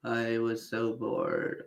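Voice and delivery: deflated mock boredom; exaggerated boredom. Shown as a demonstration. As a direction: drag it out, utterly bored. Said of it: "you can take it to".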